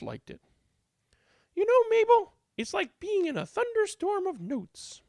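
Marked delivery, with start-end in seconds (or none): 1.5-5.0 high fluttery lady; much higher, gossiping; lady chatting with Mabel, much higher; Blanche. A narrator's reading.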